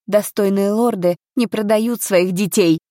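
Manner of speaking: ordinary delivery, nothing marked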